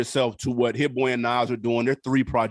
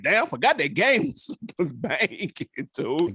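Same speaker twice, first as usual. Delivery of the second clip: higher, amused rediscovery; retold pleasant surprise; 4 semitones higher than usual. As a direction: recall being impressed, laughing mid-line. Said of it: "I was like".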